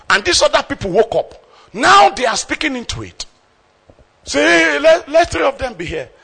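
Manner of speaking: rising to a high, loud cry; excited Peter. Acted. Preacher voicing Peter's eager plea, loud and high. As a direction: blurt it out, all eager.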